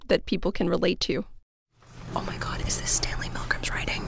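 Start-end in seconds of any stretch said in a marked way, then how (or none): 2.1-4.0 hushed, astonished library whisper. Real, not acted. Two researchers find Milgram's writing in the archives, whispering. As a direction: whisper it under library rules. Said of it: "we had to whisper because".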